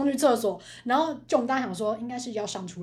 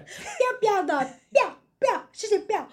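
mocking echo; 8 semitones higher than usual. To her cohost, mock-echoing his silly phrase, teasing high singsong. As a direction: toss the phrase back to tease her.

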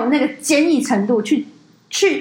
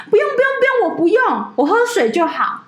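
high, hurried "no no no, I'm fine"; polite refusal; 10 semitones higher than usual. Retold or imitated. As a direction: mimic a flustered official waving it off. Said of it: "你知道那外省怎样吗".

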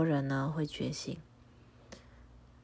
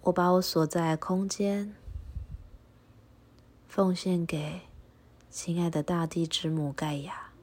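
prayer recitation; 3 dB louder than usual. Acted. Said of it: "那请你和我一起重复以下的话".

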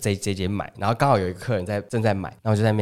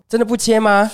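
insistent auntie; 10 semitones higher, 3 dB louder than usual. Retold or imitated. Auntie pressing again.

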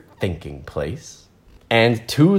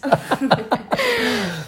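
high falling laugh; real laughter trailing off; 4 dB quieter than usual. Real, not acted. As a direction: still laughing at Marilyn. Reader laughs.

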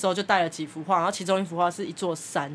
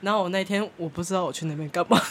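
ordinary talk, nothing marked

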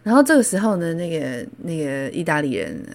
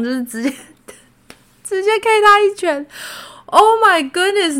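much higher, louder excited retelling; 5 dB louder than usual. Real, not acted. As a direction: burst out in shock and glee. 讲到打架激动. (激动地高声惊呼)

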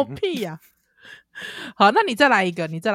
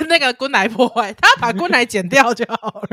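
ordinary delivery

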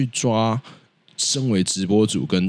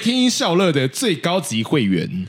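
sales pitch voice; 8 semitones higher than usual. Pretend.

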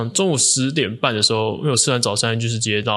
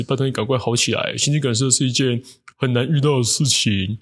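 mock eulogy; 3 semitones higher than usual. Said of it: "完全就是直接就是本色演出啊".

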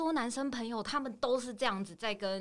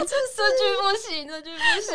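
high, loud laugh-cry; laughing despair; 13 semitones higher, 7 dB louder than usual. Real, not acted. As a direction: laugh helplessly at the awful line. Laughing-crying at the bad line.

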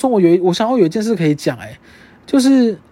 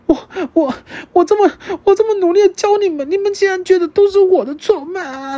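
melodramatic wounded teacher; 11 semitones higher than usual. Retold or imitated. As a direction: ham it up like a TV drama teacher. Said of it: "我以为我在看三立耶". (夸张的三立剧式受伤老师腔)

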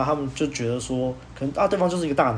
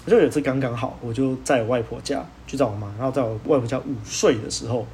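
ordinary delivery

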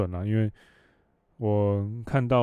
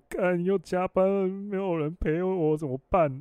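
mock crying whine; 9 semitones higher, slower than usual. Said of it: "在那边哭".

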